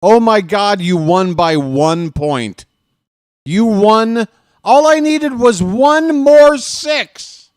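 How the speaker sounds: high, loud slow wail of defeat; real despair; anguished shout. Real, not acted. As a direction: howl the loss, stretched out. Loses game by one point.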